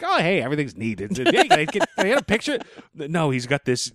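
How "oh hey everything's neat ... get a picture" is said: high-pitched, hammed-up Jay Leno voice; Jay Leno impression. Host imagining a comics writer's voice, mock Jay Leno. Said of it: "pictured his voice almost like, like Jay Leno".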